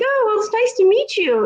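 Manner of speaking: high, chirpy mock neighbor; imitating Vanessa. Vanessa greeting narrator in the yard, high chirpy. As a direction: gush the greeting politely. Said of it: "And she's like".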